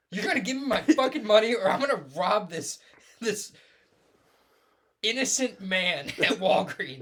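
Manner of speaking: high, loud hammed-up shakedown; mock furious demand. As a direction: ham it up as a raging caller. Mocking the angry caller.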